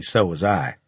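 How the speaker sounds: plain reading voice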